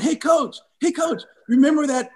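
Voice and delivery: very high-pitched, frantic retold appeal; urgent calling out. Player running to the sideline to warn coach, urgent. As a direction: imitate an anxious player hailing the coach.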